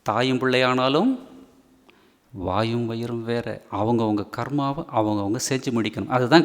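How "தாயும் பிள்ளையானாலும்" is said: ordinary talk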